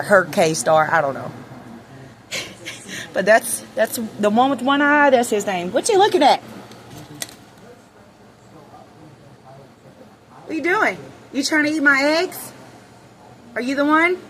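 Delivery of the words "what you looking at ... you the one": high teasing voice at a bird; pet talk. Real, not acted. Owner questioning a hen in a high pet voice. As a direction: tease the chicken gently. Talking to a chicken.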